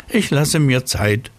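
snail voice. Acted. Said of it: "sagte die Schnecke".

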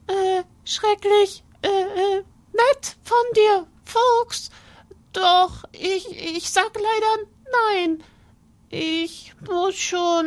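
high squeaky mouse, stammering; nervous little mouse. Acted. Mouse politely refusing the fox, high and hesitant.

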